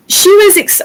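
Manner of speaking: ordinary talk, nothing marked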